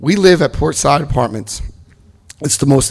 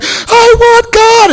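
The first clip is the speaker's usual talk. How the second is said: much higher, louder, slow sobbing wail; wailing plea; 8 dB louder, much slower than usual. Retold or imitated. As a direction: wail it out in tears. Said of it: "I'm like".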